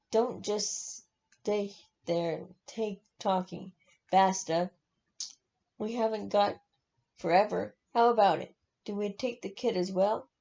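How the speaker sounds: ordinary delivery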